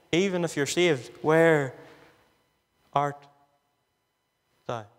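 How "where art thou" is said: low, slow call with long pauses between the words; God calling. Acted. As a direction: let each word hang like God calling. Refrain, low and spaced.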